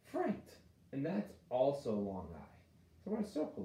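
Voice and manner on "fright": "fright" leaps up high; blended answer. Shown as a demonstration. Teacher blending the word for kids, high and bright. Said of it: "I G H is gonna make the I sound".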